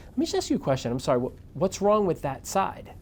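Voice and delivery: high, probing, slowed at end; curious question. Retold or imitated. Reporter questioning [name] about her bad side, curious. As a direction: ask it with genuine curiosity. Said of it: "So I say to her".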